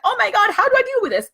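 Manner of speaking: imitating a panicked person; panic mode. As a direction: squeal it out like you're overwhelmed. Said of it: "you're in panic mode".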